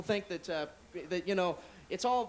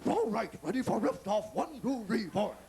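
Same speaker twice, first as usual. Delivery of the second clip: countdown car voice; 2 semitones higher, slower than usual. Retold or imitated. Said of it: "the car told me".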